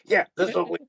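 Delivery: high cartoon teen voice; goofy high-pitched quote; Beavis and Butthead. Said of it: "on the Beavis and Butthead Do".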